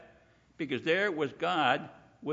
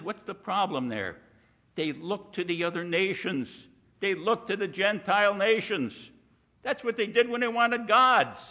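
ordinary talk

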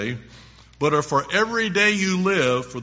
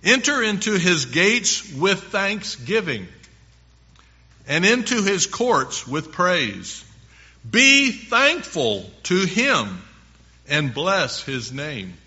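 louder, higher, measured proclamation; scripture reading; 4 semitones higher, 5 dB louder than usual. Acted. Preacher reads the psalm's call to enter with thanksgiving, proclaiming scripture. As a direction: proclaim the verse, weighting each phrase.

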